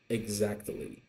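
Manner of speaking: ordinary delivery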